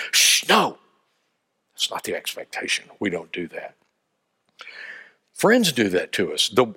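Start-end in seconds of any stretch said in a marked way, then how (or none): 0.1-0.8 sharp shush, then a high no; shushing refusal. Acted. Party people silencing a dissenting thought, shushing refusal. 1.7-3.7 low, soft, confidential reproof; hushed disapproval. Acted. Party people correcting one of their own, hushed disapproval. Garment people hush dissent.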